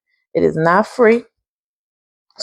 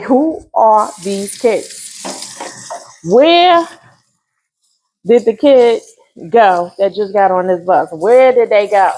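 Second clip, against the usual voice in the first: mock disbelief; slower than usual. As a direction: act stunned at the kids' change. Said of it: "I be like".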